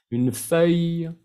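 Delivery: Une feuille slow, ending /fœj/ without a final vowel. Shown as a demonstration. Teacher showing students to drop the final e. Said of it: "pero es la E, quítale".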